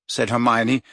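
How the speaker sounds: usual speaking voice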